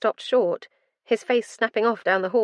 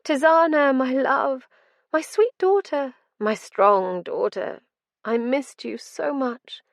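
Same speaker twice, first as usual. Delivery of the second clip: higher, slow, yearning mother's voice; longing mother; 7 semitones higher, 5 dB louder, slower than usual. A narrator's reading. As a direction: plead like a lost mother. Ghostly mother longing for daughter.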